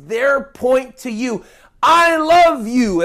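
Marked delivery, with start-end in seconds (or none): 1.8-3.0 high, loud, drawn-out declaration; all-caps yell. Shown as a demonstration. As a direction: shout each word slowly and emphatically. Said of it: "in all caps".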